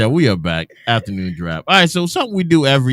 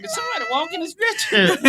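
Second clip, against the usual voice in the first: mock surprise; 5 dB quieter than usual. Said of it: "I'm like".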